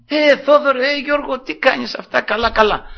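high-pitched mock hello between old friends; acted greeting. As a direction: hail a friend you bump into. Acts out friends meeting by chance. (ψιλή, ζωηρή φωνή χαιρετισμού)

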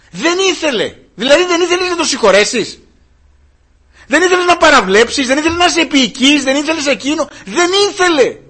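high, loud rebuke from the pulpit; fiery preaching. Acted. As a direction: hammer the congregation with every question. Preaches at full force. (φλογερό κήρυγμα, δυνατά και ψηλά)

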